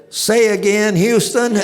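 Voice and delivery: playful imitation of the pilot ignoring the order; hammed-up astronaut brushing off the abort call; Neil Armstrong quote. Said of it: "Neil Armstrong would have said".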